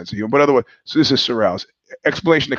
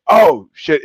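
high, loud yelp of surprise; startled recognition; 7 dB louder than usual. Retold or imitated. Recognising a jujitsu partner as she turns around, startled. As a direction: burst out with a retold shock of recognition.

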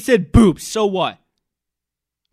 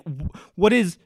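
hammed-up dudebro voice, louder; mock Clay Travis; 2 semitones higher, 8 dB louder than usual. Mocking Clay Travis's inner voice, loud dudebro. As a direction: play the macho troll figuring out his stunt. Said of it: "he's like".

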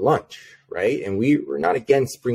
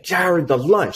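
much higher mock yell of Jared's name; mock scream; 9 semitones higher, slower than usual. Irate office manager shrieking at the rep, mock scream. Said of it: "she starts screaming".

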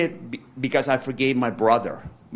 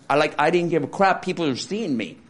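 usual speaking voice

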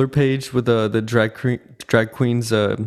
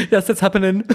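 higher, hammed-up catchphrase delivery; imitating a British queen; 9 semitones higher, much faster than usual. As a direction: push it up into a campy quote. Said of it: "She's always like".

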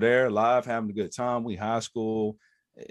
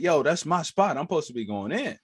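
loud, higher indignant protest; retold indignant complaint; pissed; 6 semitones higher, 4 dB louder than usual. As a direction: claim your spot, mildly outraged. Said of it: "I'm pissed because I'm like".

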